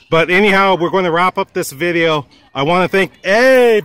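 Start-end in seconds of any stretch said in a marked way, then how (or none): none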